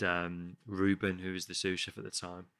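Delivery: ordinary talk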